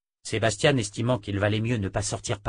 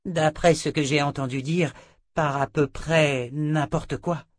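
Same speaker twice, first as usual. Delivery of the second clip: higher-pitched, deliberate woman's voice; Perdita, answering; 7 semitones higher, 4 dB louder than usual. A narrator's reading. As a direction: answer carefully from hearsay. Sister Perdita answers Sébastien. (sœur qui répond, voix aiguë)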